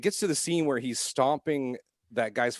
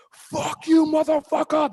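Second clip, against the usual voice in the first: much higher, much louder crowd yell; 9 dB louder, slower than usual. Retold or imitated. Audience yelling at cops on screen, loud yell. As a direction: scream it at the screen in fury. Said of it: "They're just like fucking yelling".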